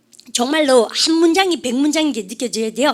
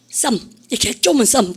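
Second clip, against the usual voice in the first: ordinary talk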